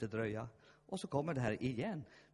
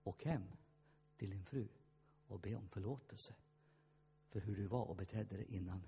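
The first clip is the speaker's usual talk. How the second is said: quiet inner prompting; 5 semitones lower, 6 dB quieter than usual. Retold or imitated. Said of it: "så känner han bara".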